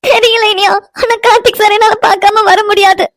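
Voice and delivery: high-pitched tearful wail; crying. Acted. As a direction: sob the words out in distress. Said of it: "Vino, அழுகாதடி". (அழுதுகொண்டே புலம்பும் குரல்)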